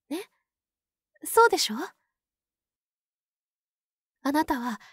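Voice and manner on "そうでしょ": louder, pressing question; insistent. Acted. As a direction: demand agreement. Pressing him to agree.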